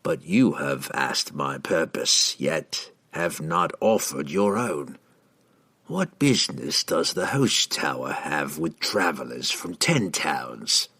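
low, slow interrogation; controlled, unhurried villain; cool assassin. A narrator's reading. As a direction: question him low and deliberately. Assassin coolly questions the wizard.

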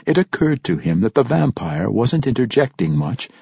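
ordinary delivery

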